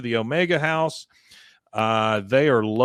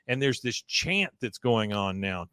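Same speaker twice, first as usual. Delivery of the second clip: ordinary talk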